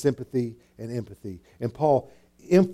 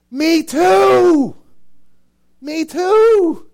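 much higher and louder; excited confession; 8 dB louder, much slower than usual. Acted. Preacher confessing his own struggles to the congregation, excited. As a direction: shout your agreement.

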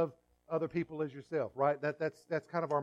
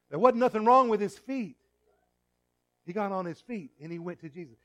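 ordinary talk, nothing marked

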